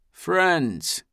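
a kindly grandfather welcoming all; grandfatherly voice. Acted. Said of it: "in his very best grandfather voice".

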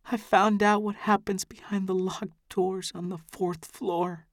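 high, soft voice catching on a hiccup; a young woman hiccuping through tears; tearful hiccuping girl. A narrator's reading. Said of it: "hiccuping halfway through the sentence".

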